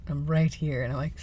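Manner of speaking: ordinary delivery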